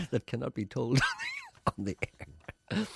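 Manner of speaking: giggling through the words; laughing. Real, not acted. Laughs at secret joke line.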